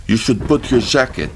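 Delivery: put-on French-Vietnamese accent. A narrator's reading. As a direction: gently urge him in her French-Vietnamese accent. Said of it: "Her accent was a strange mixture of French and".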